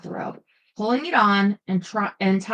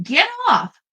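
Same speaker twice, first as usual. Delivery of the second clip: alarmed shout; 9 semitones higher, 5 dB louder than usual. Acted. Woman kicks intruder from bed.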